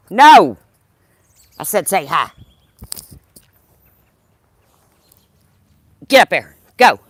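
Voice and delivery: loud, firm scold of a dog. Real, not acted. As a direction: lay down the law with the dog.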